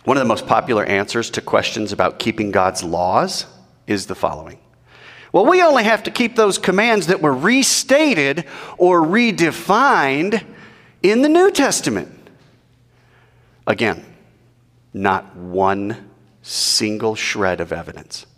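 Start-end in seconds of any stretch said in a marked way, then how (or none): 5.3-12.3 mocking voice. Mocking popular answer.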